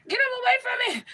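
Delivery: high, pleading cry to Jesus; mock desperate prayer. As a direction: beg Jesus to take them away, hammed up. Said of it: "I was praying".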